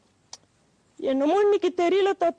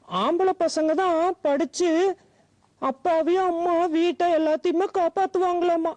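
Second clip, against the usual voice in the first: ordinary talk, nothing marked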